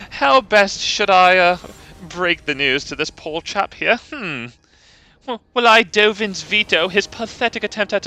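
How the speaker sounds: a smug British mage toying with his opponent; smug British villain. Retold or imitated. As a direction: savor the choice of counterspell in British. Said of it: "kind of a smug British".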